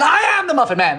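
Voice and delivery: playful high-pitched proclamation; Muffin Man proclamation. Acted. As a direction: proudly declare yourself the Muffin Man. Acted Muffin Man reply.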